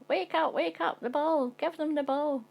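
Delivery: put-on high, excited little boy's calls; a three-year-old yelling to wake dad up; child shouting. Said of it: "He comes over to you shouting".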